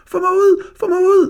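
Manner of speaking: shrieked very high and loud, in a panic; terrified howl. A narrator's reading. Jakob stuck in the window, screaming in mock panic. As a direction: howl for help as if something has you. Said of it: "gentog Jakob".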